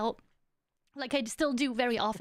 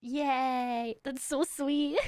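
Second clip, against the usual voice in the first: ordinary talk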